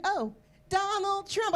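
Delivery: lightly acted kid's reply; five-year-old child. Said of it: "My five-year-old came in".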